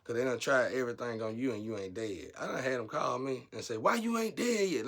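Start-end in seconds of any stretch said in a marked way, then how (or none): 3.8-4.9 high, loud, furious demand; mad as hell. Retold or imitated. Caller who tried to kill her, demanding angrily. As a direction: bark it like a caller enraged you survived. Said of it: "Like, mad as hell".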